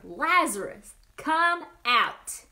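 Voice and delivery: loud commanding call; mighty yell. Acted. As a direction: roar it out like a lion. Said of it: "he yelled like a lion's mighty roar".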